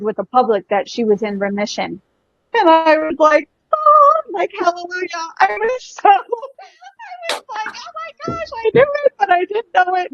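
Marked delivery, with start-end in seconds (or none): 2.5-10.1 squeaky, high-pitched delighted laugh-talk; giddy high joy. Retold or imitated. Telling the host about the miracle, high giddy squeal. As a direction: squeal it out like pure happy disbelief. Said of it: "overjoyed memory, laughing, high voice".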